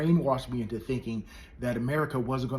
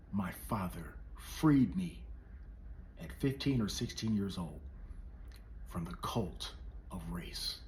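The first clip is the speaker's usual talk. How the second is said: slow intense whisper; 6 semitones lower, 8 dB quieter, slower than usual. Real, not acted. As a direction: hush it, slow and solemn. Solemn speech climax.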